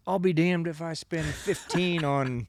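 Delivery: put-on Southern accent. Hater balking at a fifteen-dollar lure, mock Southern accent. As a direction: put on a Southern twang, scoffing at the price. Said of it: "I chose a Southern accent".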